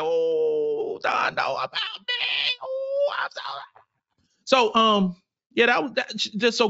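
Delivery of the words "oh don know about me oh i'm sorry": high, cracking mock-overwhelmed cry; starstruck fan. Fan overwhelmed that the coach knows him, high crying gush. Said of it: "I was like".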